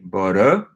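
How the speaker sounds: "bara" dipping, then lifting at the end; down-up intonation. Shown as a demonstration. Learner echoing how she pronounces it, down-up intonation on "bara". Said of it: "down, up intonation".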